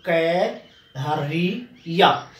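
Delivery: कै हरिया slowly split into "kai, ri, ya"; drawn-out teacher's breakdown of कै हरिया; syllable-by-syllable demo. Shown as a demonstration. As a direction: sound out each piece of कै हरिया. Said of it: "ये होता है क्रिया".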